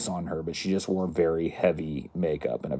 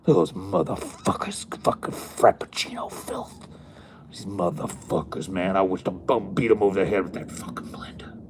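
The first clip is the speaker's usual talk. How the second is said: hissed, breathy rant; bitter muttering. Retold or imitated. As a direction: seethe under your breath once they're gone. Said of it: "she'd go".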